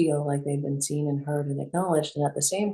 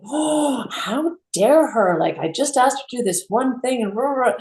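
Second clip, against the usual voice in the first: indignant outburst; 8 semitones higher, 5 dB louder than usual. Retold or imitated. Mother fuming at her daughter's refusal, loud indignant retelling. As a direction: replay the outrage, pitch flying up. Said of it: "And I just remember going".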